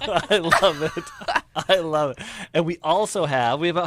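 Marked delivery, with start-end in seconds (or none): 0.0-2.2 laughing. Real, not acted. Host laughs at the accent.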